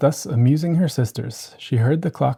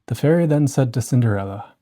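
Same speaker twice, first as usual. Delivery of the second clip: plain reading voice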